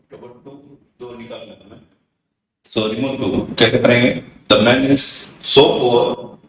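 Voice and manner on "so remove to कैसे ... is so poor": loud. Real, not acted. Teacher stresses the rule.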